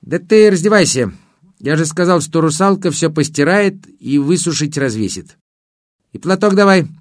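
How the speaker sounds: a hint of a chatty river spirit; water spirit voice. Water spirit hosts the guest.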